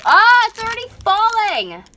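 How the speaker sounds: loud, high-pitched cry of dismay; alarmed yell. Real, not acted. Vlogger watching the taped phone fall, real high yell. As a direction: yell out as it slips away from you.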